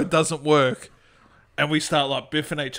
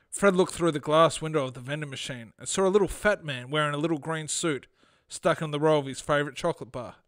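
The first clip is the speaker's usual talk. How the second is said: story narration; 6 dB quieter than usual. Acted. Podcast host reads his short story to co-host, storytelling. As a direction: read the short story aloud, composed.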